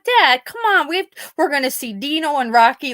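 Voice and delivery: high, eager coaxing; pleading with dad. Retold or imitated. Daughter coaxes her dying dad about the dogs, high and eager. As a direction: cheer dad up about the afterlife.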